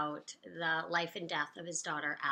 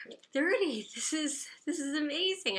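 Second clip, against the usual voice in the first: excited quote; 9 semitones higher than usual. Retold or imitated. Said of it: "I was like".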